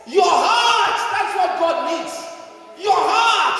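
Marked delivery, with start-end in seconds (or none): 2.8-3.6 emphatic shout. Acted. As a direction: proclaim it to the crowd. Preacher's loud emphatic repeat.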